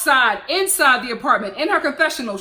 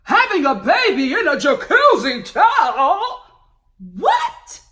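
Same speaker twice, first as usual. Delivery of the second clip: lightly voiced mock-shocked husband, rising higher; mocking Russ; 4 semitones higher, 5 dB louder than usual. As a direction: imitate the husband gawking at the tub. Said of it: "looking all".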